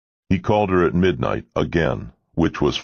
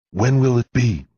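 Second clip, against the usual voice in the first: high, eager, louder question; excited; 8 semitones higher, 4 dB louder than usual. A narrator's reading. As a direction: ask it bursting with excitement. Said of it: "Finn asked excitedly".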